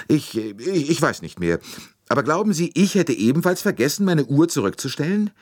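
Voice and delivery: high-pitched, indignant protest in character; stammer, then object in a high voice; flustered woman. A narrator's reading. Flustered Mrs Hubbard protests to Poirot.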